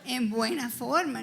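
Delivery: real laugh while backpedalling. Real, not acted. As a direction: laugh through the correction. Laughing while correcting herself.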